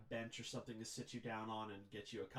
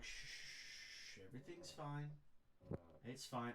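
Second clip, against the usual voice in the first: soothing hush; 7 dB quieter, slower than usual. Acted. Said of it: "he gently kind of lays your head".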